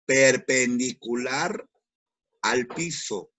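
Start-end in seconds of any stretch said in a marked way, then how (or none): 0.1-1.7 Perpendicular said slowly and louder; slow emphasis. Shown as a demonstration. Teacher stressing the key word to students, slow and louder. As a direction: spell out Perpendicular slowly, each syllable weighed. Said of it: "¿Cómo se dibuja?".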